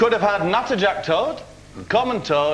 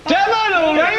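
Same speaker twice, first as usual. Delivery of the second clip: excited cheer; 12 semitones higher, 4 dB louder than usual. Real, not acted. Hosts cheer correct answer.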